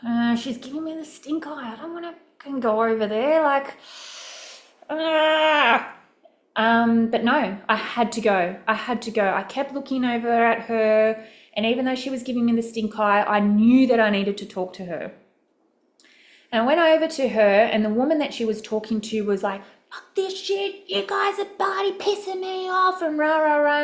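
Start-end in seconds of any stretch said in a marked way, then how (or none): 0.1-5.9 higher-pitched, dragged-out inner protest; whiny reluctance. Retold or imitated. Speaker replays dreading a stranger's stink eye, whiny. Voicing own reluctance to approach her. 19.9-23.8 much higher-pitched imitation of griping; mock angry rant. Storyteller mimics a fed-up woman on a bench, mock angry.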